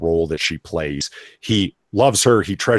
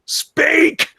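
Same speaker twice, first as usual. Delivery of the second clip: a sudden, much higher, louder shout of one word; yelling; 5 dB louder than usual. Acted. Kingpin demands his comatose wife speak, yelling. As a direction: bark the command at her, full voice. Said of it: "as he yells".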